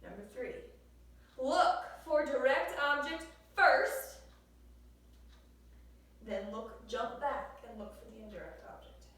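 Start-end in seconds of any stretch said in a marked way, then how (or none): 1.4-4.2 loud, slow, every word stressed; emphatic teacher rule. Shown as a demonstration. Teacher hammering a rule home to the class, slow and loud.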